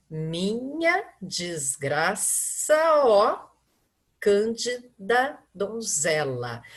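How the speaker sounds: very slow, "desgraça, ó" run together as one syllable; slow scansion reading. Shown as a demonstration. Teacher showing students how vowels join, very slow. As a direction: draw out each syllable so "ça" and "ó" merge. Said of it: "Vejam que ele ficou na mesma sílaba".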